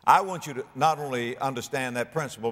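normal talking voice

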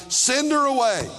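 high, exasperated "Send her away"; annoyed disciples. Retold or imitated. Disciples complaining to Jesus about her, exasperated. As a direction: shoo her off, fed up. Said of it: "She won't stop shouting".

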